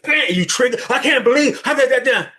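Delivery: high, loud startled outburst; mock triggered outburst. Acting out being triggered.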